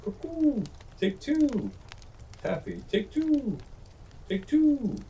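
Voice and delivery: very high cooing sing-song bird voice; a silly dove calling to herself; silly turtle dove. A narrator's reading. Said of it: "that silly turtle dove crying".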